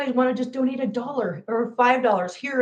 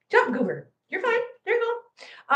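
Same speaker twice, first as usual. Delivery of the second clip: pet talk; 10 semitones higher than usual. Real, not acted. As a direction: coax in a light, raised pitch. Coaxing a pet to jump.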